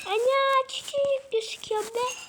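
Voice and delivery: high, loud surprised exclaim; startled by the tiny picture; surprised cry. Real, not acted. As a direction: squeal it in shock.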